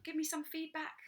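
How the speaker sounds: usual speaking voice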